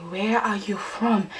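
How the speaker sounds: slow, softened, word-by-word question; careful mimicked question; imitated careful English. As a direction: ask it slowly, like a learner trying out English.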